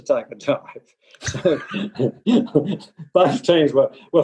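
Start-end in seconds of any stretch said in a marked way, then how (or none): 1.6-2.8 amused laugh. Real, not acted. Laughs at the absurd story.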